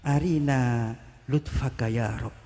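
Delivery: slow, soft Arabic supplication; the saint asking God quietly for gentleness; soft humble plea. Retold or imitated. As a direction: drop to a gentle, slow entreaty. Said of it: "Doanya cuma itu saja".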